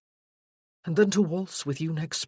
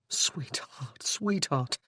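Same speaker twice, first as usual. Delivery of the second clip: tender whisper; 2 semitones lower, 3 dB quieter, much slower than usual. Acted. Tender soothing of frightened fiancée.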